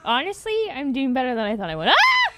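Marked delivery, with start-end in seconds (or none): 1.9-2.3 sudden very high, loud shriek; shriek, cutting off your own sentence; startled scream. Real, not acted.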